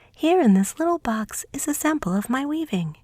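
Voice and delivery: high, eager little mouse princess; high little mouse, fond and eager. Acted. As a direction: play the tiny mouse sweetheart, high and lively. Mouse hands Veiko the nutshell.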